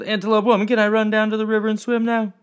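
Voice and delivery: high, loud and fast asking; boy eagerly begging his mother to go swim; eager pleading. Acted. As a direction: beg to be let off to swim.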